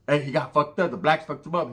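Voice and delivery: ordinary delivery, nothing marked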